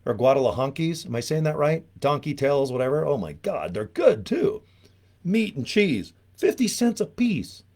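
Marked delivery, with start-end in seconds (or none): 3.7-7.6 excited about tacos. Real, not acted. Raving about cheap tacos.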